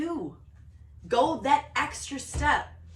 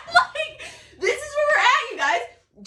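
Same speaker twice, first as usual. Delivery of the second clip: very high, loud squeal through laughter; shrill-pitched, overexcited cry; giddy outburst; 13 semitones higher, 7 dB louder than usual. Real, not acted. Giddy after laughing at herself.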